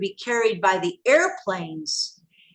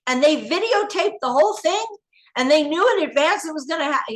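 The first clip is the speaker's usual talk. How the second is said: upset and indignant; 8 semitones higher than usual. Retold or imitated. Furious at her handler that nobody told them, high outraged. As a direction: pour out the betrayal, rising in pitch. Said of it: "I was very upset".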